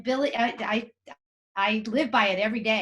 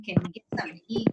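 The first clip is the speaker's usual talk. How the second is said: put-on quick, breathless whisper of a tired kid; whispered kid's plea; 3 semitones lower, much faster than usual. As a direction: whisper it fast like a worn-out child asking for food. Child whining to parent after match.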